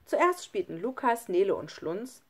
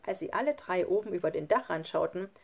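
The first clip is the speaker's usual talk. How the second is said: ordinary delivery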